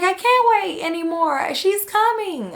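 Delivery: high, strained desperate cry; mother in labor crying out. Retold or imitated. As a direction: plead that you can't hold it in.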